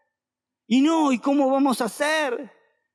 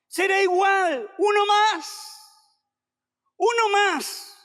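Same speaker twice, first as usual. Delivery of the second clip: emphatic preaching; 7 semitones higher, slower than usual. Acted. Preacher echoing David's confidence.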